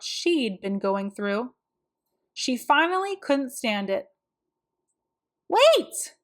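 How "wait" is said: high, loud call after someone; a girl yelling wait; urgent cry. A narrator's reading. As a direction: shout to stop her leaving. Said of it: "she cried".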